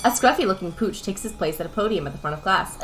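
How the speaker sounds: ordinary delivery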